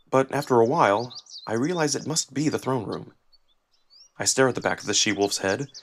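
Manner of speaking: ordinary delivery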